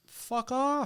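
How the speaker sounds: high, drawn-out retort; retold exasperated dismissal, stretched out slowly; indignant brush-off. Retelling his retort to a stranger.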